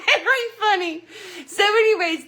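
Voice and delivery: high, loud laughing words; real laughter. Real, not acted. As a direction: still giggling as you move on. Laughs remembering socks.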